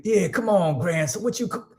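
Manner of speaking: put-on high-pitched old-school grandpa voice; grandfather's old-time twang. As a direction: goad a grandson in an imitated elder twang. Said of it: "Yeah, that's how, that's how he's".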